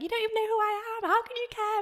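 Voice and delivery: high-pitched mock disbelief; retold incredulous protest. Protesting to a stranger who says she cares, incredulous. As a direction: push back in a high, disbelieving voice.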